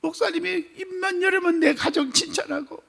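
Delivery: high, sobbing plea for forgiveness; tearful apology. Retold or imitated. Deacon tearfully apologizing to the pastor, crying high. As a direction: weep out the apology, voice breaking high. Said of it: "하고 펑펑 우는 거야".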